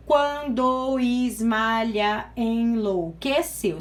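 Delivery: "Quando Ismalha enlouqueceu" said very slowly, syllable by syllable; slow syllable count. Shown as a demonstration. Teacher counting syllables for the class, slow and separated. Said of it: "Se você contar".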